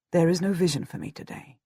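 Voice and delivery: plain reading voice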